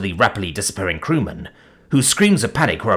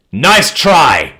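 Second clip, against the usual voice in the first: loud, higher defiant snarl; 7 semitones higher, 9 dB louder than usual. A narrator's reading. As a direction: throw it back with a snarl. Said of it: "he snarled".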